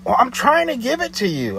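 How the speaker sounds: imitating the caller insisting she is trying; defensive caller. Her defensive retort.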